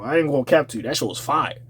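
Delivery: ordinary talk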